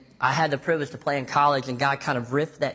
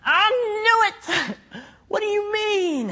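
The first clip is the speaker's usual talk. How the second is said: much higher, loud, gleeful cry; Mary bursting with I-knew-it joy; excited Mary; 5 dB louder, slower than usual. Retold or imitated. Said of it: "I wonder if Mary goes".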